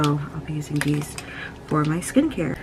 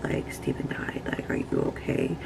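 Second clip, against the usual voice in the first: a whispered, worried question; hushed concern; 9 dB quieter than usual. Retold or imitated. Friends whispering concern after her cat died. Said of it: "everybody was like".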